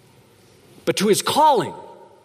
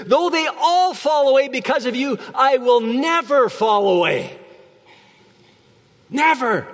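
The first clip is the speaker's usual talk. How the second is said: high, proud, slow vow; Peter's boast; 9 semitones higher than usual. Acted. Peter vowing loyalty to Jesus, high proud boast.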